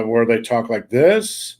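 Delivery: slowly, lifting "this" sharply like a question; rising end inflection. Shown as a demonstration. Asking co-host about California speech, "this" swung upward. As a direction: Californian uptalk, pitch climbing on "this". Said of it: "there's an inflection at the end".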